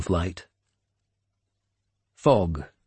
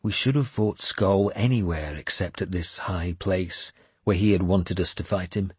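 usual speaking voice

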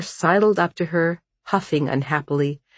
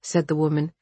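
plain reading voice

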